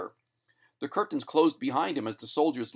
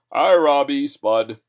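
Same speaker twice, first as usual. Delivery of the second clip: loud, projected stage hail; announcer's loud greeting; 3 semitones higher, 11 dB louder, slower than usual. A narrator's reading. Announcer greets Spud on stage, calling out loudly. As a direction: call out heartily to a theatre.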